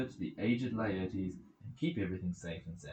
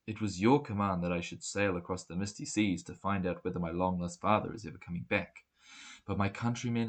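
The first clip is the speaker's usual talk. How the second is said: a lightly voiced young man's heartfelt prayer; earnest prayer; 5 dB louder than usual. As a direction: beg Athena from the seashore. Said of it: "This was his prayer".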